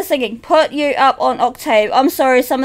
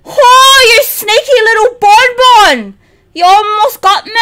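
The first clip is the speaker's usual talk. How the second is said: relieved excitement; 5 semitones higher, 5 dB louder than usual. Real, not acted. Near miss in game.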